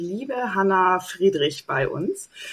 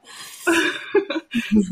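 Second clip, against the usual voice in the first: laughing; 8 semitones higher than usual. Real, not acted. Host laughs at the joke.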